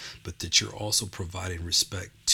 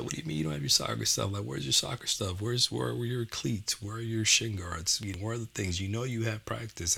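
higher, fast stern scolding father; 3 semitones higher than usual. Retold or imitated. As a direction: scold her like a strict dad. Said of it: "I'm talking very sternly".

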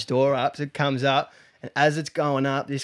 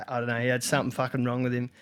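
normal talking voice

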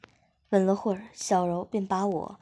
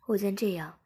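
plain reading voice

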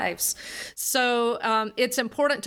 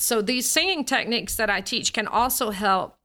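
ordinary talk

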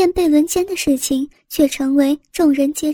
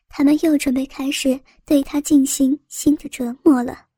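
usual speaking voice

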